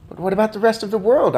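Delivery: put-on a boy blurting out the question fast; cried out. A narrator's reading. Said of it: "I cried".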